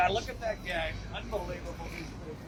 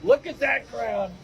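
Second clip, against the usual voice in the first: rally-stage Trump, self-congratulating; Trump impression. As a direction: brag to the crowd like a showman president. Trump show, Mister Trump.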